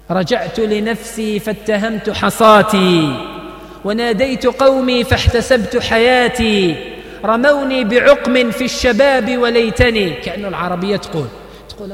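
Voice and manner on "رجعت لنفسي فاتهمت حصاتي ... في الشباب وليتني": slow, louder chanted verse; poem recital. Acted. Preacher reciting Hafez Ibrahim's poem to the congregation, slow and loud. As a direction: declaim each line slowly with weight. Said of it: "على لسان اللغة العربية".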